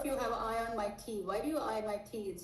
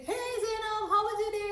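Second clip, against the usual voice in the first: much higher and louder greeting; over the top energy; 12 semitones higher, 7 dB louder than usual. Shown as a demonstration. Coach showing a too-loud greeting to a calm client. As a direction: blast the greeting way too high. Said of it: "someone who's more calmer".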